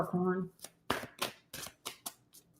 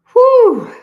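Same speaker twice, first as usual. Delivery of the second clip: relieved whew; 11 dB louder than usual. Real, not acted. Reader exhales after heavy reading.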